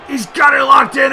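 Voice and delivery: keyed-up ringside shout; tense excitement. Real, not acted. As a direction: call it urgently as the hold locks. Calling a submission hold.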